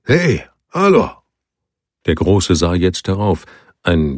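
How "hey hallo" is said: high, loud call across the river. A narrator's reading. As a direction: shout it down to the men below. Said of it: "Er räusperte sich".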